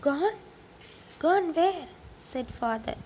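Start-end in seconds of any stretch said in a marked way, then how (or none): 0.1-1.9 loud, high startled questions. A narrator's reading. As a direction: ask sharply, taken aback. Father hears his son is missing.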